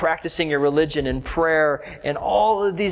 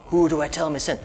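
ordinary talk

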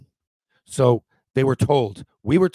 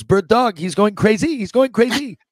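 alarmed report; 8 semitones higher, 6 dB louder than usual. Retold or imitated. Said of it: "and he goes".

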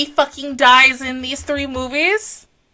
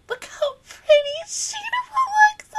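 much higher, near tears with delight; squealing gush; 4 dB quieter than usual. Real, not acted. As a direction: squeal at how pretty she is. Gushing over a character.

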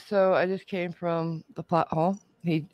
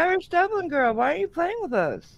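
calling out; 11 semitones higher than usual. Real, not acted. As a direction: call over excitedly in a high voice. Calling out to a newcomer.

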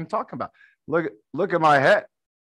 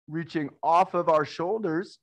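ordinary talk, nothing marked

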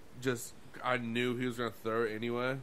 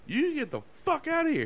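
much higher screamed order; furious chef yelling; 14 semitones higher than usual. Retold or imitated. Drenched chef screaming at the cook to leave. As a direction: scream at him to get out. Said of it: "she was like".

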